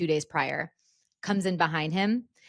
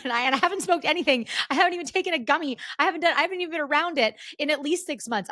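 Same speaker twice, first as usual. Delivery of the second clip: high, fast nervous insisting; frantic denial; 9 semitones higher, faster than usual. Retold or imitated. Insisting to the nurse, high and fast. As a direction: rush to prove innocence.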